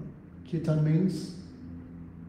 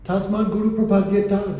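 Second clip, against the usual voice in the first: louder, higher, declaiming the verse; emphatic recitation; 4 semitones higher, 6 dB louder than usual. Shown as a demonstration. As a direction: proclaim the Sanskrit line with force. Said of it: "therefore you must go to guru".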